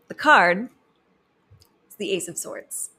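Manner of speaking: suspenseful tarot-card announcement; dramatic reveal. Acted.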